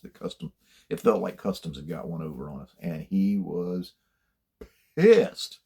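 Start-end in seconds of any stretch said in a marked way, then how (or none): 5.0-5.6 one loud, high-pitched burst; emphatic outburst. Retold or imitated. Officer telling how furious his boss was, emphatic outburst. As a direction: punch it out high and loud after a pause.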